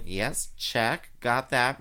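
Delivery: usual speaking voice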